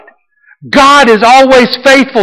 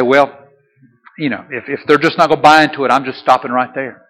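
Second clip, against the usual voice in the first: low, soft, offhand aside; mock giving up; 8 semitones lower, 6 dB quieter, faster than usual. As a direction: mumble it low and quick, as if backing out. God imagined shrugging off his promise.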